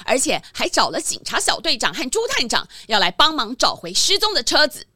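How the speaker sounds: ordinary delivery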